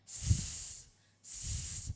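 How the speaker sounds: two long snake hisses. Shown as a demonstration. Teacher drills the S sound, two hisses. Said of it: "S sound is".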